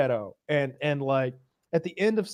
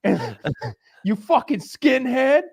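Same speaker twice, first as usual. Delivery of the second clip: slow, loud, higher mock bravado; puffed-up taunt; 10 semitones higher, 8 dB louder than usual. Larry confronting the bald man, hammed-up loud bravado. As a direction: swagger into the insult. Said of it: "He's like".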